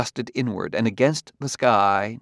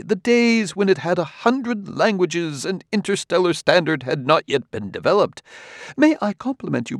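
higher, lilting, effusive talk; suave, gushing host; 7 semitones higher than usual. A narrator's reading. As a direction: flatter the guest with swooping enthusiasm. Amadiro gushes to his guest.

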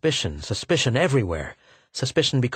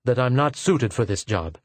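plain reading voice

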